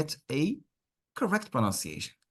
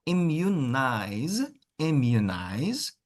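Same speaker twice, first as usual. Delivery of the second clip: drawn out, syllable by syllable; slow model; much slower than usual. Shown as a demonstration. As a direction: stretch "Immunize" out slowly. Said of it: "teacher models word slowly".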